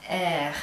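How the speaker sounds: practice-model French "air", low in the throat; low, throaty French letter R; guttural French R. Shown as a demonstration. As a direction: let the French R come from deep in the throat. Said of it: "down there in your throat".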